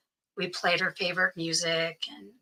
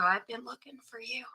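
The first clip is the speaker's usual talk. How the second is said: soft in her ear; 4 semitones higher, 8 dB quieter than usual. Retold or imitated. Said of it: "I leaned in her ear".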